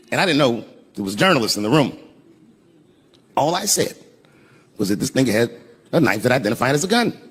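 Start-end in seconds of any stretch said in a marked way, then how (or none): none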